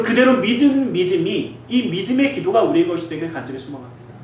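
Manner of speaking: ordinary talk, nothing marked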